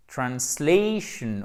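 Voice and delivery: English model "translation", stress on SLAY; correct pronunciation. Shown as a demonstration. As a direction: stretch the ay diphthong in "translation". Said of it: "Donc c'est pas translation".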